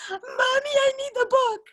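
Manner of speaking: put-on teary little girl begging for her book; whiny child. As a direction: whimper it like an upset toddler. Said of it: "when she says".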